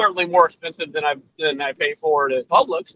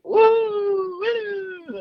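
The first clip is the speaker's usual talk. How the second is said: long high "woo"; mock excited whoop; 3 dB quieter than usual. Cohost proves he has energy, mock whoop. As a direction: whoop high and drawn out, then let it drop. Answers teasing about low energy.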